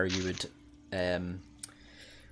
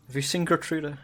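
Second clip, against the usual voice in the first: higher, lightly voiced tearful mother pleading; sobbing mother; 8 semitones higher, 3 dB louder than usual. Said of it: "and she's still sobbing".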